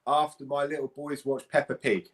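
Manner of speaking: ordinary talk, nothing marked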